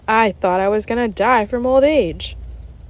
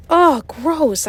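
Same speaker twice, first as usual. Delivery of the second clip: high, loud disgusted cry; shouted; 7 semitones higher, 4 dB louder than usual. Acted. Boy reacting to the awful gum, loud and high. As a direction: recoil at the taste. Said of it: "I shouted".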